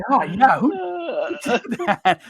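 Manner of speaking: laughing, loud fake innocence; mock innocence. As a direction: play dumb while laughing. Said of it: "you're like".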